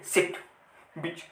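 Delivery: सिट and बीच both with a quick short i; short vowel. Shown as a demonstration. Teacher recapping short sound for students, short vowels in सिट, बीच. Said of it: "हमें ज़्यादा sound देने की ज़रूरत नहीं पड़े".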